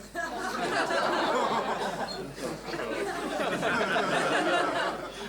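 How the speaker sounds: long stretch of laughter; a drawn-out chuckle. Real, not acted. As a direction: break up laughing at the story. Laughing at ketamine story.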